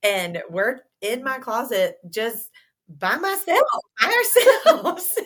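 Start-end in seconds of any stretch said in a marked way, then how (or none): 3.2-5.2 high, loud words broken by laughter; laughing. Real, not acted. Laughing while telling birth story.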